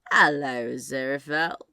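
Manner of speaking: lightly voiced drawling Crowley. A narrator's reading.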